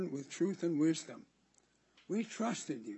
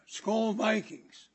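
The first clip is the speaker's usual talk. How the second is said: higher, louder, slow stretched-out cheer; team cheer; 6 semitones higher, 4 dB louder, much slower than usual. Acted. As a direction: call it out like a fan in the stands. Cheering the home football team.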